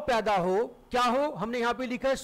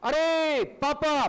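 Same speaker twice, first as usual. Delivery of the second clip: अरे पापा called out slowly, higher and louder, पापा drawn long; calling out; 4 semitones higher, 3 dB louder, much slower than usual. Shown as a demonstration. Child calling out to father, long and loud. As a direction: shout across the house for papa. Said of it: "किसी को आवाज दे रहे".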